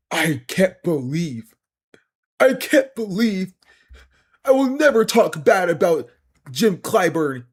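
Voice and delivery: hammed-up gushing supporter voice; mocking impression. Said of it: "They're like".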